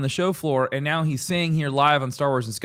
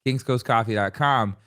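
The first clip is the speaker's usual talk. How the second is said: coffee plug in put-on Long Island accent; 3 semitones lower than usual. Host sends listeners to the coffee site, attempted Long Island accent. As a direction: drop the r's, Long Island style. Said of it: "like, Long Island accent".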